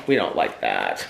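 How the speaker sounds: low mock-stern grumble; mock grumpy disapproval. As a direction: grumble it like a disapproving old crank. Stickers coming off.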